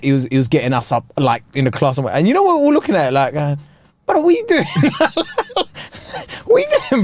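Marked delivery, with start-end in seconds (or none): 4.1-7.0 high laughing disbelief; mock disbelief. Students mocking the teacher's sudden effort, high laughing disbelief. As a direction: squeal it out in mock shock.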